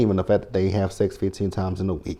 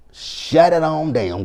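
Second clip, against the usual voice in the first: slowed, drawn-out, higher command; slow emphatic; 6 semitones higher, much slower than usual. Retold or imitated. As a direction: stretch every word to end it. Telling Letitia to stop.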